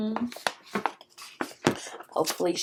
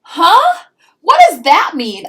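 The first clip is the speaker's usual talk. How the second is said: loud, much higher confused huh; puzzled; 10 semitones higher, 13 dB louder than usual. Acted. Pinkalicious reads the fairy's note, loud puzzled outburst. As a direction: blurt out your confusion. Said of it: "I wondered".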